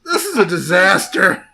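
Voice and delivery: loud, high melodramatic wailing; mock despair. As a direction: wail it like a hammy tragedy. Mock despair about guest.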